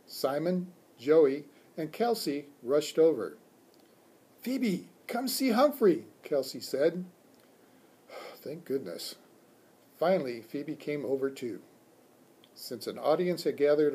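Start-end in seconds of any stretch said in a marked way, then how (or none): 4.4-6.1 put-on high-pitched girl's call; high, eager schoolgirl summons; child calling a friend. A narrator's reading. Child calls friend over to look. 8.1-9.2 soft sigh of relief; softly sighed relief; relieved sigh. A narrator's reading. Relieved sigh as attention comes.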